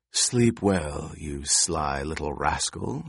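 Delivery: drawn-out crone farewell; low, slow old crone. A narrator's reading. Crone bids boy goodnight.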